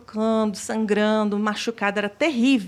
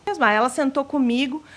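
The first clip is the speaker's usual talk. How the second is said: ordinary talk, nothing marked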